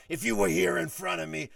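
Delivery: heated mock menace; retold angry threat. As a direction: threaten him as the raging boss. Boss threatens him in retold row.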